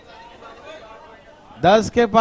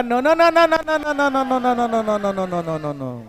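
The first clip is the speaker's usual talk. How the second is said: mock protest voice; 5 dB quieter than usual. Teacher voicing a number's protest, squeaky no's fading out. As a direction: voice the term refusing to go inside. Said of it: "अंदर जाके क्या बोलेगा?".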